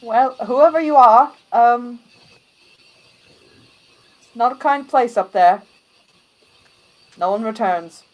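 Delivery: loud, firm gate guard; stern guard. Acted. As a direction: bar the way like a soldier. Guard warns about demons.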